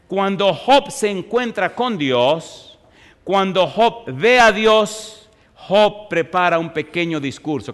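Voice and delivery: solemn pulpit build-up; slow emphatic preaching. Acted. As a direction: build toward Job's meeting with God.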